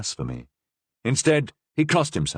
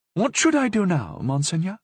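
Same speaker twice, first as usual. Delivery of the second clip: meek; 10 semitones higher than usual. A narrator's reading. Superior voice.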